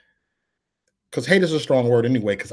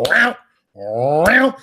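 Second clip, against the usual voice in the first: rising, drawn-out rolled noise; imitated gang call; 5 semitones higher, 4 dB louder than usual. Preacher retelling his gangbanging days, imitating a rolled noise. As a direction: roll the sound upward into a burst.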